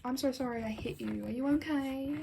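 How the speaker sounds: normal talking voice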